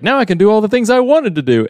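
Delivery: high, fast, gleeful quote; mock glee. Last man on earth free to do anything, gleeful. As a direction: imitate someone suddenly free, rushing it out high.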